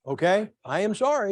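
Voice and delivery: usual speaking voice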